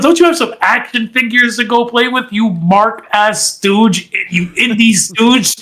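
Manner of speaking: mock yell. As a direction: drag out the insult with scorn. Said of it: "He goes".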